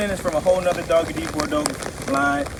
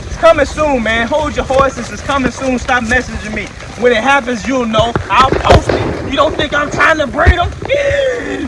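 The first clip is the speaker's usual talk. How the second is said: excited; 8 semitones higher, 8 dB louder than usual. Real, not acted. Answering impatient viewers.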